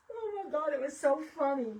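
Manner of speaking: laughing. Real, not acted. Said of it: "I'm about dying laughing".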